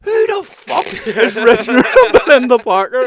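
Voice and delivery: high, loud put-on voice breaking into laughter; imitating exasperated dad. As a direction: act out the dad losing it, laughing through it. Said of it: "he goes like".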